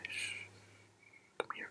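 ordinary talk, nothing marked

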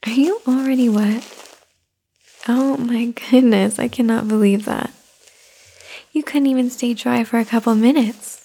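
normal talking voice

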